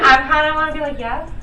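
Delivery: excited. Real, not acted. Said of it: "excited wish, loud high".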